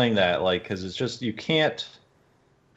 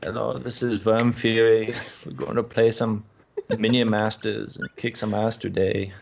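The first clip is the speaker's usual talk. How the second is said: mock German accent. Teasing a co-host by faking the guest's German accent. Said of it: "Tell him to do a German accent".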